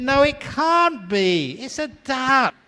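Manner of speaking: very high, drawn-out mock protest; exasperated husband. Husband contradicts wife about the bird, high comic exasperation. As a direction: argue back in a high, stretched voice. Acted couple's escalating argument.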